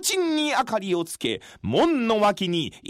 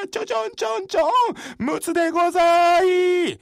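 drum beat and call; 7 semitones higher, 3 dB louder than usual. Acted. Beating drum, calling hour.

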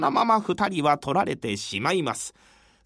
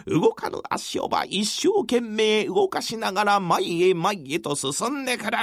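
plain reading voice

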